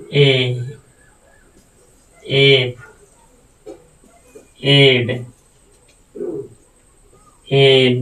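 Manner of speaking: ᱮ, ᱮᱯ, ᱮᱰ, ᱮᱱ each said alone, loud, with a pause after it; letter sound demo. Shown as a demonstration. Teacher drilling the letters ᱮ ᱮᱯ ᱮᱰ ᱮᱱ aloud. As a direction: sound out ᱮ ᱮᱯ ᱮᱰ ᱮᱱ one by one, clearly. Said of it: "ᱪᱮᱫ ᱞᱮᱠᱟ ᱵᱚ ᱩᱪᱪᱟᱨᱚᱱ ᱠᱮᱫᱟ".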